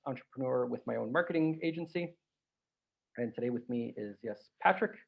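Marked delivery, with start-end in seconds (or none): none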